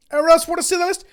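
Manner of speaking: loud, high call to the whole room. Real, not acted. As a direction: call it out so everyone hears. Calling out again, louder.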